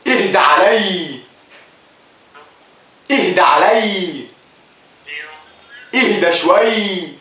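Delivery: slow loud drawn-out "اهدى" shouted; pleading shout at the phone to calm down; yelled calm down. Shown as a demonstration. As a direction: bellow "اهدى علي" slowly, stretching each word. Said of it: "أتحداك إنك تتكلم أي حد وتقول له".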